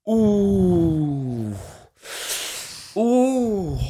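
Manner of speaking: crowd's shocked ooh. Retold or imitated. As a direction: imitate a shocked audience going ooh. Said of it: "the whole crowd just went".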